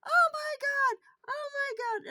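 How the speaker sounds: high, loud panicked shriek; retold screaming. Retelling panic over spilled cash, a loud high scream. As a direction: cry it out as if the cash is gone. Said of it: "and I'm screaming and crying".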